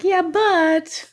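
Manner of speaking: mock objection. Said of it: "you're like".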